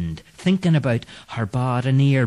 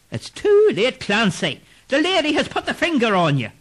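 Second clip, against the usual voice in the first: ghost's verdict; 13 semitones higher than usual. Acted. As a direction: tell him his fate. Ghost Bopper pronounces doom.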